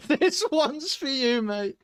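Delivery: high, loud, drawn-out put-on voice; Borky. Sideline official presenting the board to a player, high slow mock voice. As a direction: stretch it out, pitched right up.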